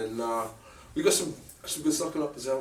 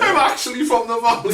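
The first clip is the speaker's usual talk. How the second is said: laughing mimicry; 12 semitones higher, 11 dB louder than usual. Teasing repeat of bandmate's line.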